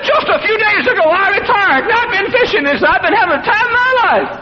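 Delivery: put-on high thin old man voice; a frail old man, delighted; squeaky old man voice. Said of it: "in a squeaky voice".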